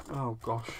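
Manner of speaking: normal talking voice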